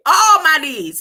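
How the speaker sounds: "all" high and very loud, falling through "my needs"; stress on ALL. Real, not acted. Preacher sums up the listed needs, impassioned stress on "all". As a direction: cry out "all" as the climax. Climax after listing the needs.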